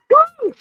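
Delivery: shrill, very high whoop of celebration; host whooping for the winner; loud cheer. Real, not acted. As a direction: let out a big party whoop.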